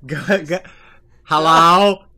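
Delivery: loud hello into a bad line; three-way phone call. Real, not acted. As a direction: call out to check they're still there. Call connection dropping out.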